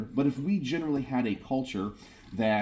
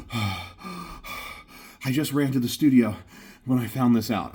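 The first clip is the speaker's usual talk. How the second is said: mock out of breath; slower than usual. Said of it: "he was out of breath".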